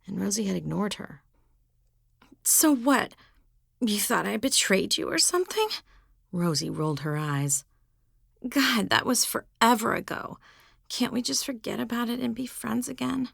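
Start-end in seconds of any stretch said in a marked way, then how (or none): 2.4-5.7 put-on a touch of a snippy teenager; higher, challenging teen voice; lightly voiced teen girl. Rosie confronting Vanessa. 8.5-13.3 a hint of whiny teenager; higher, breezy teen pleading; lightly voiced teen girl. Rosie pleading casually.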